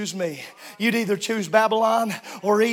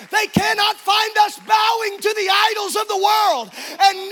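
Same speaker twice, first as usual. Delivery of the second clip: rousing sermon climax; fiery preaching; 3 semitones higher than usual. Acted. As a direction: preach it out to the whole church. Preacher at full force.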